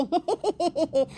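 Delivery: short scornful laugh at his excuse; mocking laugh.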